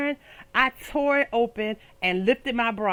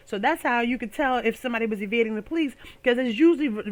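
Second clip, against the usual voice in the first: ordinary delivery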